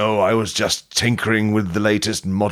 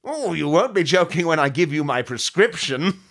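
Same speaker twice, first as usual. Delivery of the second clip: villain voice; 7 semitones higher than usual. Acted. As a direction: gloat like a pantomime baddie. Villain voice continues.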